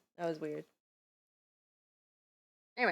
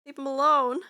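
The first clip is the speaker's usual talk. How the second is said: scolding shout; 7 semitones higher, 9 dB louder than usual. Real, not acted. Scolding bird stealing her.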